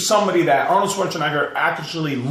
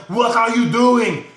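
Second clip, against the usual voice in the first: higher, slow Austrian bodybuilder voice; Arnold impression; 5 semitones higher, 3 dB louder, slower than usual. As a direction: growl it out like Arnold Schwarzenegger. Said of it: "That's my best Arnold impersonation".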